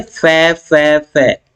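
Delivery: फे फे फे repeated as a breathy F onset; f sound demo. Shown as a demonstration. Teacher demonstrates the repeated F sound to students, isolated and breathy. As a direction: spit out फे फे फे to show the sound. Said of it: "इस तरह की sounds".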